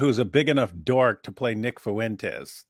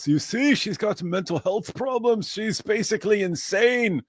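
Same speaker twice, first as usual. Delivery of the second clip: high mocking impression of critics; 8 semitones higher than usual. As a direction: sneer it in a high voice. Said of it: "And they're all".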